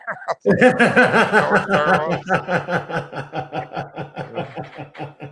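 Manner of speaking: big high laugh rolling down; long loud laugh. Real, not acted. After the slowest car reveal, a long real laugh. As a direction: crack up at your own punchline. Punchline about the other car.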